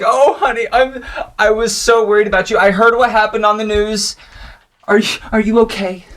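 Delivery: high-pitched fretting mother; anxious motherly concern, pitched high; worried mom. Acted. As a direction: fuss anxiously over your child. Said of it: "Wow, she's worried".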